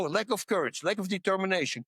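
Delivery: ordinary talk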